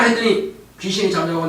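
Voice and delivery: usual speaking voice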